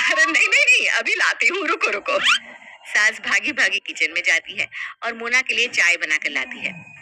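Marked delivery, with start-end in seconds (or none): 0.0-2.2 higher, flustered cries; scared hurry. Acted. Frightened mother-in-law promising tea, flustered and high. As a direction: panic to please her.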